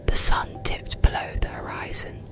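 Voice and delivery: usual speaking voice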